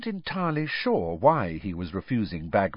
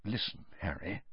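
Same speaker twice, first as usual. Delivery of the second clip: much softer, hushed; very low voice; 13 dB quieter than usual. A narrator's reading. Bagman drawing Harry aside, very hushed. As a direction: murmur it close to his ear. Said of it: "still in a very low voice".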